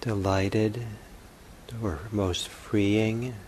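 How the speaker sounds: unhurried meditation voice with long pauses; calm contemplative guiding, phrase by phrase; guided meditation. Acted. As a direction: softly offer questions, leaving silence between them.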